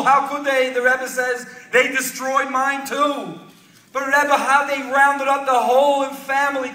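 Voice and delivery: high, drawn-out retelling of a lament; impassioned lament. A narrator's reading. Man tells rebbe of destroyed family, impassioned retold lament. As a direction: pile up the losses with a preacher's fervor.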